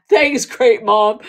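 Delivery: loud, slow, laughing thanks. Real, not acted. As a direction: say it loudly through laughter. Teased about voice, laughing.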